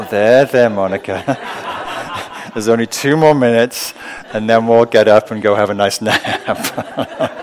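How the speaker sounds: loud, laughing there-there soothing; laughing mock soothing. Teacher mock-soothing herself through laughter, loud and laughing. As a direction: soothe yourself while laughing.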